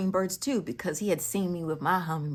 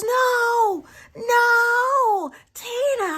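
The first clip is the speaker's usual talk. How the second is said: very high, loud impassioned cry; retold scream; 11 dB louder, much slower than usual. Brother on the phone to his sister, excited yelling. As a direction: wail it out like an overexcited brother. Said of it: "he screams and he yells".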